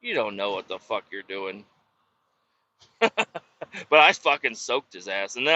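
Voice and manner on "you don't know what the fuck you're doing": lower, much softer dismissive mutter; softly spoken mock insult; quiet put-down. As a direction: brush him off under your breath. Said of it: "I'm like".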